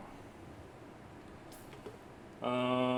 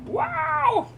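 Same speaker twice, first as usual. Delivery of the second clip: sudden very high loud wow; surprised; 8 dB louder than usual. Real, not acted. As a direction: shriek of surprise.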